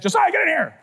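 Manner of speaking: high-pitched acted dad's yell; summoning shout. Father summons son.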